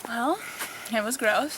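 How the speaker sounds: talking while chewing gum; words round a piece of gum. Real, not acted. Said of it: "That's why I'm chewing gum right now".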